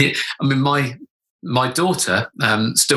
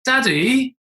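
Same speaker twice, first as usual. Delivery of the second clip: higher, daughter's little call; 10 semitones higher than usual. Retold or imitated. Daughter calling dad from outside the cubicle, little voice. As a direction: call out high, like a child through a door. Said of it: "heard this little voice from outside".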